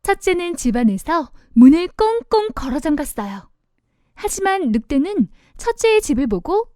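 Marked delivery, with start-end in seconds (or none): none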